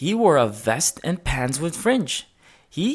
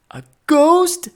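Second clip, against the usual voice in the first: much higher, louder, alarmed; panicked; 14 semitones higher, 7 dB louder than usual. A narrator's reading. Another guest echoing in panic, higher and louder. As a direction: shriek the question in fright.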